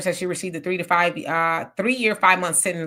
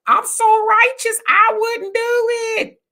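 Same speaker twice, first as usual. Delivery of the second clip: much higher and louder, whiny mock cry, slowing down; mock righteous wail; 7 dB louder, much slower than usual. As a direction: ham up a fake pious protest. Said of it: "I'm not gonna lie to y'all and say".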